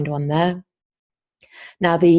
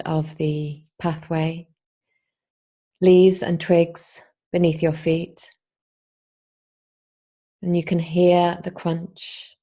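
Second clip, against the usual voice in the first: guided meditation. Acted. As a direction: guide the listener unhurriedly, leaving space between phrases.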